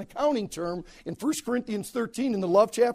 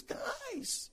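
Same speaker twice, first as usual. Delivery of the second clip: tearful; 17 dB quieter than usual. Retold or imitated. Joseph weeping to brothers.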